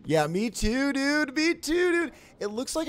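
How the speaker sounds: squeaky mock bro voice; high bro voice. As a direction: pitch up into a silly dude voice. Mocking bro voice, rising.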